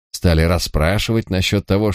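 usual speaking voice

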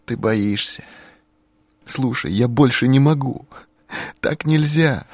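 high, pleading, near tears; begging to be believed; desperate young woman. A narrator's reading.

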